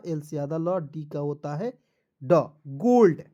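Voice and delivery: ordinary talk, nothing marked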